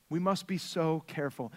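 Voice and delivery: hushed, grave warning; quiet. Real, not acted. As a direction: drop the voice on "We must be so careful". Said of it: "repeated warning".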